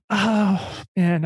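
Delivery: usual speaking voice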